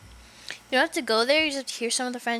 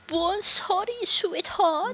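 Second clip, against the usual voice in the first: high-pitched mock French accent; higher, hammy French guest voice; 8 semitones higher, slower than usual. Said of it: "I don't know how to sound French".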